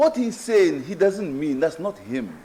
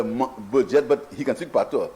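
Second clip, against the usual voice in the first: ordinary delivery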